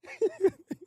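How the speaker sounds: high burst of laughter. Real, not acted. Laughing at own rant.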